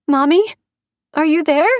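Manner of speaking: put-on high, loud scared child calling out; a small girl calling for her mommy; frightened little girl. Kidnapped child calls for mother.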